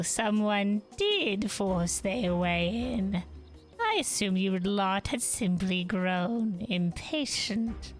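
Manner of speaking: slow, menacing villain drawl; slow theatrical villain menace. Acted. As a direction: savor each word like a gloating villain. Villain voiced slowly.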